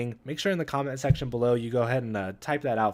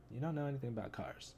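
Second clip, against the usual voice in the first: much softer mutter to himself; quiet repeat; 13 dB quieter, faster than usual. Shown as a demonstration. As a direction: say it again under your breath. Repeats comic line twice.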